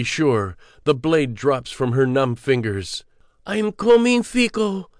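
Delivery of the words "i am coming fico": reach out to the dead with a high, straining voice; desperate farewell cry. Acted. Claudia's dying call to loved ones.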